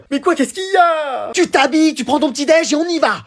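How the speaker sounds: high-pitched scolding orders; exasperated parent. Acted. Parent rushing late child to dress, exasperated. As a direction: give orders in a high, insistent voice.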